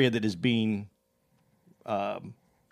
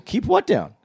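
higher, genuinely baffled question; innocently puzzled; 8 semitones higher than usual. Retold or imitated. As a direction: ask back with no smart-ass edge. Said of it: "I wasn't even trying to be a smart ass".

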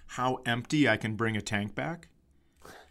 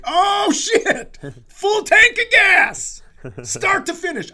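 gleeful victory; 10 dB louder, slower than usual. Retold or imitated. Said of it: "I'm doing a dance like".

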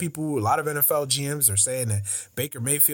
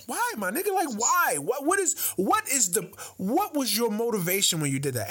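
exasperated pleading; 6 semitones higher than usual. Real, not acted. Exasperated questioning.

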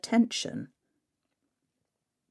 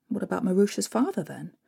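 ordinary delivery

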